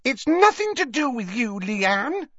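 put-on high-pitched indignant girl's voice; Katy, shrill arguing. A narrator's reading. Katy arguing with her friend over something in her hand, shrill. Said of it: "had become shriller".

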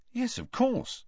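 high, mildly puzzled reply; slightly nonplussed. A narrator's reading. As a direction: answer a touch taken aback. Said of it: "slightly nonplussed".

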